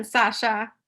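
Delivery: ordinary talk, nothing marked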